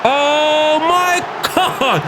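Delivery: a long, high, pained "oh my God"; anguished drawn-out cry. Real, not acted. Gamer watches the penalty go in, long anguished cry. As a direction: stretch it out in real despair.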